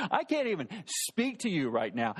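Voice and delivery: ordinary delivery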